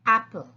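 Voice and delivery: apple said clearly and loud; model word. Shown as a demonstration. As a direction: say apple with a short open a. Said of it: "So say".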